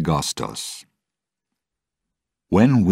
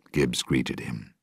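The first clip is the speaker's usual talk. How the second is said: plain reading voice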